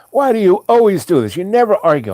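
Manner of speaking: high, fast, exasperated complaint; imitated tizzy. Wife upset that husband won't argue, high hurried complaint. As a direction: mimic a spouse itching for a fight. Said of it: "get in a tizzy about something".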